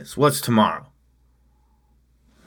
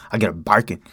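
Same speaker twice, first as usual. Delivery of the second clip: ordinary delivery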